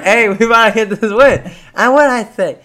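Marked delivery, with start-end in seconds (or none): 0.0-1.5 friend's excited boast, higher voice; cocky hyped-up quote, pitched up; imitated hype. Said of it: "He was pretty hyped".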